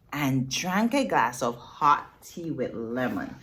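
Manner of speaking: usual speaking voice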